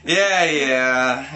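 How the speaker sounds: loud brushing-off; dismissive. Acted. Narrator shrugging off Liv's panic, dismissive. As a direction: wave it away.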